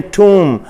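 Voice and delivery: "टूम" said clearly as toom with a long oo; model pronunciation. Shown as a demonstration. Teacher saying tomb for students to repeat. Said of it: "pronunciation सुन लें".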